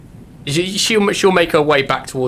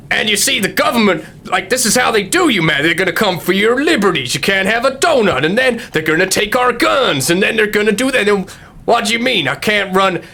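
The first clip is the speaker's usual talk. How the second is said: higher, ranting conspiracy trucker; 7 semitones higher than usual. Acted. GM as the trucker, ranting at the rattlesnakes about liberties. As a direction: rant about the government like a riled-up trucker. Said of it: "He's like".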